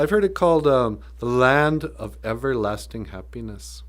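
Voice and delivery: normal talking voice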